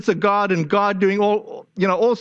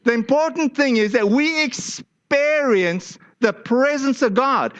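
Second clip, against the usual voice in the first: normal talking voice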